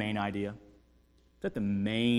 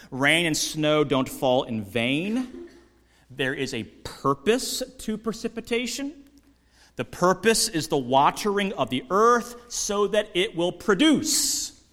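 louder, higher, driving each point home; forceful preaching; 7 semitones higher, 6 dB louder, slower than usual. Acted. Pastor to his congregation, preaching at full force.

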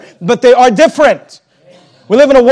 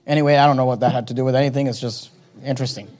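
muttered aside; 9 semitones lower, 9 dB quieter, much faster than usual. Real, not acted. Throwaway aside after a point.

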